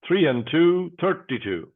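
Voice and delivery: steady, rhythmic number calling; bingo caller. Acted. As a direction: call each number out, then pause.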